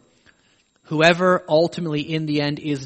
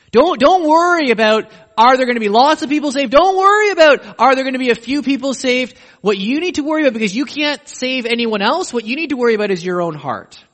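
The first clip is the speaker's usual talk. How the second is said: louder and higher, pressing the point home; forceful exhortation; 6 semitones higher, 7 dB louder than usual. Real, not acted. Preacher urging his congregation, loud and higher. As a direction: urge them hard not to worry about numbers.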